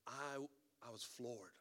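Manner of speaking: ordinary talk, nothing marked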